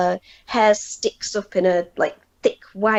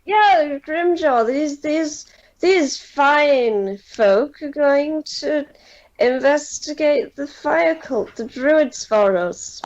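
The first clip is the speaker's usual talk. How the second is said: much higher, slow formal announcing; pompous captain; 6 semitones higher, slower than usual. Acted. Captain presents the party to Grimjaw, high and formal. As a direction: present the guests with puffed-up ceremony.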